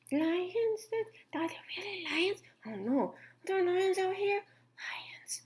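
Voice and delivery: high, soft, breathless scared whisper; frightened whisper. A narrator's reading. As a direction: whisper in fear of lions. Animals frightened of lions.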